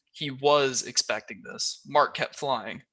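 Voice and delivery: ordinary delivery, nothing marked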